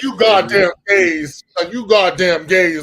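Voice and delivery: slow, loud, drawn-out diva voice; hammed-up fond scolding, slowed down; imitating the actress. Host quoting the actress, a loud slow diva scold.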